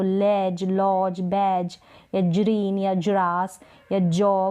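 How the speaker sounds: slow, deliberate लेज, लॉज, बैज with a soft j; wrong soft g. Shown as a demonstration. Teacher to students, showing the wrong pronunciation slowly. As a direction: model the mistaken soft g in लेज and जॉ. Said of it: "ऐसे तो नहीं बोल रहे हैं".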